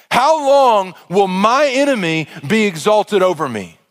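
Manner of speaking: a pleading scripture reading, lingering on "How long"; fervent psalm reading. Acted. Reads Psalm 13 with fervour.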